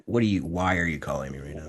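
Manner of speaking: grumpy phone answer. Retold or imitated. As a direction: grumble it like you were woken up. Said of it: "Like how I answer".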